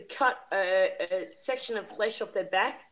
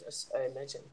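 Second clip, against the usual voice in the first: ordinary delivery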